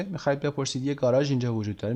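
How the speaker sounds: usual speaking voice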